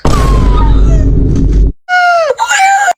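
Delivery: long, very high, loud gasping scream; terrified shriek. Retold or imitated. Storyteller reliving seeing someone in the bathtub, terrified shriek. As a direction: shriek in drawn-out terror.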